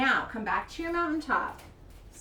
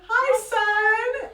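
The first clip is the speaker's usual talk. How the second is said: calling out hi; 10 semitones higher, 9 dB louder than usual. Shown as a demonstration. Greeting the sun in kids yoga.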